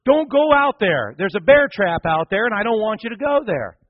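high, loud father's warning shout; yelling out. Acted. Father calling his little girl away from danger, yelling out. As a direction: shout an urgent warning across the yard. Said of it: "And he yells out".

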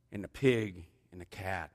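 ordinary delivery

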